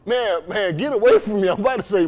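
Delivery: ordinary delivery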